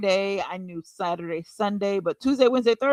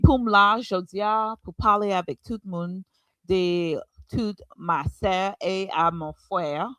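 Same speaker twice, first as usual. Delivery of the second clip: missionary Creole accent. Shown as a demonstration. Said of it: "can you give us a small demonstration".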